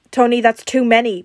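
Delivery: loud, urgent protest; alarmed warning. A narrator's reading. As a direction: try to stop him at once. Bruce alarmed at overdose.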